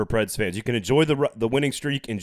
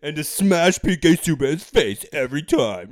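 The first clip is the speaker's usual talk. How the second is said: slow, louder mock rant; mock conspiracy voice; 4 semitones higher, 5 dB louder than usual. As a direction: add to the paranoid bit. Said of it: "now do".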